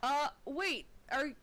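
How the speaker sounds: rising to a high surprised question; a hint of a double-take; suspicious guard. Guard spotting stranger in tent, surprised challenge. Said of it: "and he says".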